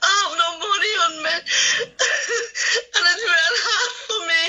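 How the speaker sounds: real sobbing, voice breaking high; crying. Real, not acted. Stranded diabetic caller pleading to radio host, sobbing. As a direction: choke out the words through tears. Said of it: "Don't cry, ma'am".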